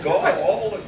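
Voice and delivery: lightly hammed Jasper accent on the exclamation. Repeating the Jasper impression for laughs, put-on Jasper accent. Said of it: "that's my Jasper in-, impression".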